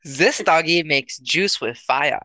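slow, put-on German accent; deliberate German-accented parody; mock German accent. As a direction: draw it out in a fake German accent. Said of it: "went off of the accent from".

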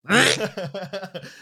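a loud high burst of laughter. Real, not acted. Laughing at his friend's dialect remark, a loud laugh.

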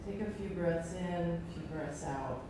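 soft, calm breathing guidance; guided meditation. Acted. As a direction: ease them into stillness.

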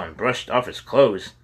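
plain reading voice

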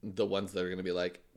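usual speaking voice

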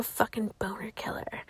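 soft unvoiced whisper; whispered punchline; whispered aside. Real, not acted. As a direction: mutter the aside under breath.

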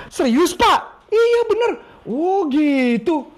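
acted sales chat. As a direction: play both sides with eager interest. Coach acts sales dialogue.

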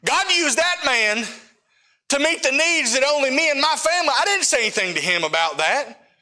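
high, fervent testimony, pushing up; impassioned preaching. Acted. To the congregation, about God providing, fervent preaching. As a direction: preach it with rising fire.

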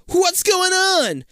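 high, slow panicked question; trapped kid calling out; alarmed cry. Acted. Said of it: "you yell".

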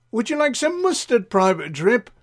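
high-pitched eager offer; sing-song high voice of an attentive server; fussing Doris. Acted. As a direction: pipe up sweetly to interrupt, pitch way up. Doris fussing over Private Drip.

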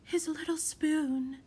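high, slow, wistful murmur; trembling murmur. A narrator's reading. Mother staring into distance, sad wistful murmur. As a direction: murmur it as if lost in memory. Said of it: "lips trembling, and murmured".

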